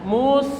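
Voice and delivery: MUS with rounded u, back to a thin s; rounded mu, clean s. Shown as a demonstration. Teacher modelling the correct ending, clean s. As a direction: pucker for MUS, then release into s. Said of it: "esnya kembalikan".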